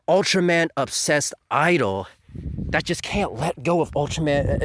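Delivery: normal talking voice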